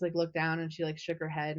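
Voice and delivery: usual speaking voice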